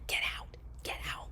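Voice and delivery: urgent hushed whisper. Retold or imitated. Boyfriend whispering to get her out. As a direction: hiss it so the kids stay asleep. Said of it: "he's whispering".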